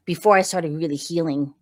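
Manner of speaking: usual speaking voice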